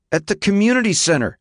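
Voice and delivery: high-pitched, exasperated answer; defensive teenage son. A narrator's reading. Son answering his father's questioning, defensive and high. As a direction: answer back like a cornered son.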